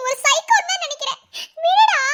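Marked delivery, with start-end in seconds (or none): 1.7-2.0 laughing yell. Real, not acted. Wife yelling to be let go.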